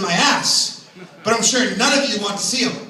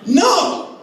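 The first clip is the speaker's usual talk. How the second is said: shocked no; 9 semitones higher than usual. Real, not acted. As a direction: blurt out in shock. Shocked reaction.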